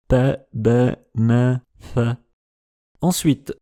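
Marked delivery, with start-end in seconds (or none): 0.1-2.0 P, B, N, F with tongue tip on upper lip; touch tongue to upper lip for P, B, N, F; linguolabial consonants. Shown as a demonstration. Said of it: "le bout de la langue contre la lèvre supérieure".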